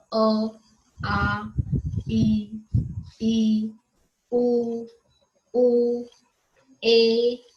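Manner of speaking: each vowel said alone, clearly, with a pause after it; reciting Hindi vowels. Shown as a demonstration. Teacher to young pupils, reciting the vowels one by one. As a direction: call out each swar slowly for the class to copy. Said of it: "जैसे आप हिंदी में बोलते हो".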